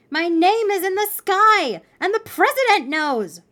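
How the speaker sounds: put-on high, loud child's announcement; excited shouting. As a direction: shout amazing news to wake your sister. Child excitedly shouts news to sister.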